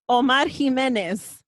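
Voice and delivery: Omar Jimenez in a Spanish accent, slow. Shown as a demonstration. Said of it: "let me say it to you with my very Spanish accent".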